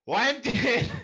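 high, badly faked Australian accent; bad Australian impression. Shown as a demonstration. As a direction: attempt a strained, high Australian twang. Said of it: "an impression of Liler doing an impression, an Australian accent".

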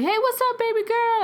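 high-pitched, singing hello to a daughter; happy dad greeting. Retold or imitated. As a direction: imitate a dad delighted to get the call. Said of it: "picked up the phone so happy".

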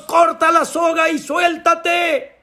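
high, slow, drawn-out shout; shouted command. Acted. God to the clinging climber, shouted command. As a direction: call it out as a final order. God's final shouted order.

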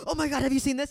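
high, loud gushing; excited. Retold or imitated. Movie lover running into a friend at the theater, excited. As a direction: burst out with it like a thrilled fan. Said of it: "He gets so excited".

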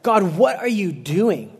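slow, drawn-out exasperated complaint; mock angry protest. Angry king to God after a man dies, mock angry protest. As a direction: act out a mildly angry challenge to God. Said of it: "it says, he is angry".

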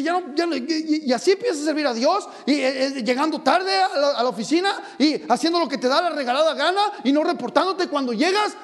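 scolding pastor. Retold or imitated. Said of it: "he chewed my face there".

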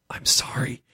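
soft, voiceless little sorry; imitating a furtive whispered apology; hushed apology. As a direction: whisper it as an aside to a friend. Child apologises before pushing friend over.

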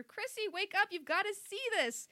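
high, urgent wake-up call; mild narrated yell. A narrator's reading. Mom coming closer to daughter's room, high mild yell. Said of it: "her mom was yelling".

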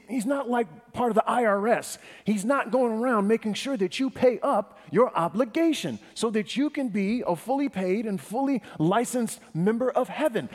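high, loud, insistent sermon delivery; impassioned preaching. Real, not acted. Preacher rejects the idea of God as taxman, fervent. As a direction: drive the point home with rising fervor.

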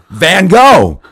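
exasperated shouted clue. Retold or imitated. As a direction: bark the clue again, as if volume will help. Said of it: "Like louder was gonna help".

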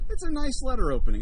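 ordinary delivery

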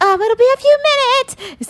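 high, strained singsong imitation; mock stalling call. Mermaid stalling the kids from behind the fence, high mock call. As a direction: call out high, then drop off.

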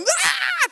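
high loud "aah" of panic; reenacted panic scream. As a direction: scream as if freaking out. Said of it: "And I went from".

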